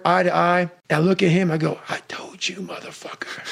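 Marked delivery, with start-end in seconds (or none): 1.9-3.4 hushed, menacing whisper; quiet whispered threat; whispered taunt. Retold or imitated. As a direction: breathe the taunt out under the breath. Repeating a taunt to his rival.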